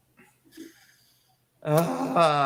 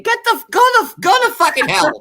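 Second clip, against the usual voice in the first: yelling furiously, much higher and louder; angry yell; 9 dB louder than usual. Real, not acted. Ranting about the reality show, yelling furiously. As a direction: spit it out in a furious outburst.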